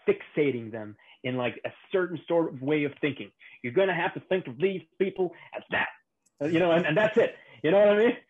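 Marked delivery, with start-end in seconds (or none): 3.6-8.2 put-on Southern accent. Joking with a podcast friend in a mock Southern accent. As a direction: slide into a hammed-up Southern accent. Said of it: "Sorry to pull off the Southern accent".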